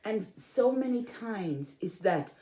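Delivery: normal talking voice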